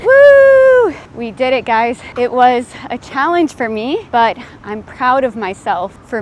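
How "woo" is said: high, loud cheer of victory; triumphant shout. Real, not acted. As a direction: yell out the finish. Finishing the sprint.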